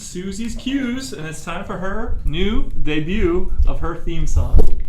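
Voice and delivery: loud, slowing host intro; announcer voice. Acted. Show host segment intro.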